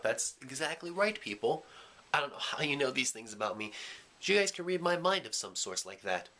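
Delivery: ordinary talk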